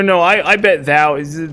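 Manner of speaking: normal talking voice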